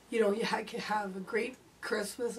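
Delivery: ordinary talk, nothing marked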